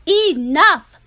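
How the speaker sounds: loud exasperated snap; fed-up mother. Acted. Mother at the end of her patience, a loud fed-up word. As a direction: cut her off. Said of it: "said her mother".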